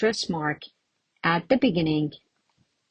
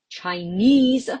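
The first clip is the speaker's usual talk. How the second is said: Chinese with NESE punched; chiNESE, clear final stress; stress on last syllable; 2 semitones higher than usual. Shown as a demonstration. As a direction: lean on the end of Chinese. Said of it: "but still on the last one".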